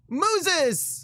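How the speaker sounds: mock exclamation.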